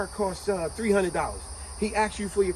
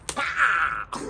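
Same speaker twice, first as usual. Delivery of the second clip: punch sound. Retold or imitated. Retelling a punch.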